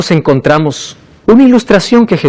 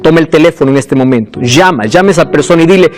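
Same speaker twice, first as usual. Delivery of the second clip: altar call; 6 semitones lower than usual. Acted. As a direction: speak low and close, pleading with the listener. Llamado al arrepentimiento con música. (llamado al arrepentimiento, voz baja y sincera)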